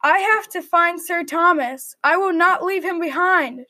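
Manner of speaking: ordinary delivery, nothing marked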